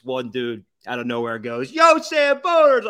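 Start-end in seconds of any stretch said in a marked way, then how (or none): 1.7-2.9 loud, high yell across a crowd; calling out. Retold or imitated. Stranger in the ballpark concourse hails them, loud yell. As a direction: holler it at a passing group.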